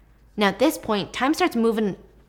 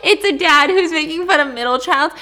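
much higher, louder sudden excitement; excited recognition; 8 semitones higher, 8 dB louder than usual. Real, not acted. Friend's remark reminds her of a TikTok, excited. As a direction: burst out as it clicks.

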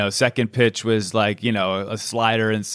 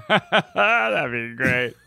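laughing; much slower than usual. Real, not acted.

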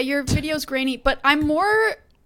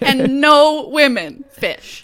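drawn out and loud; slow loud punchline; 9 dB louder, much slower than usual. Shown as a demonstration. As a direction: land it slowly and loudly. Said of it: "repeats the phrase slowly".

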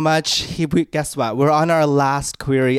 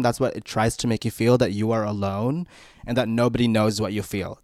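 ordinary delivery

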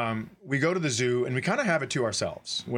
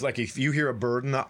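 ordinary delivery

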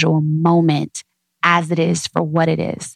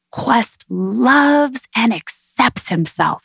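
higher, slow and tender, each word laid down gently; slow affirmation to her child; 5 semitones higher, much slower than usual. Shown as a demonstration. Mother to toddler at bedtime, slow tender affirmation. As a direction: soothe him toward sleep with a slow, lifted promise. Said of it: "with that inflection in your voice".

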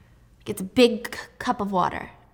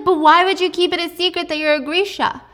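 mock shock; 8 semitones higher, 6 dB louder than usual. Wylan exclaiming.

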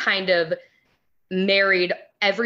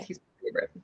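whispered; 10 dB quieter, faster than usual. Real, not acted. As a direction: breathe it out adoringly. Whispering about the baby.

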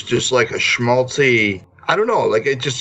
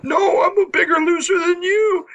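whiny mock wail. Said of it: "the other guy's like".

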